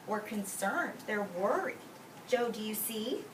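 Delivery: normal talking voice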